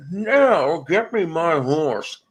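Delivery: slow, lightly voiced cartoon Mountie; a hint of a square-jawed hero, drawn out slowly; hammed-up Dudley Do-Right, slow; Dudley Do-Right impression. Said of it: "Dudley Do Right".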